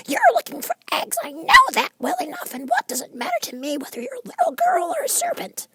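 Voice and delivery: very high bird voice, complaining; a fussy bird listing its troubles; high fretful pigeon voice. A narrator's reading. Pigeon dismisses Alice.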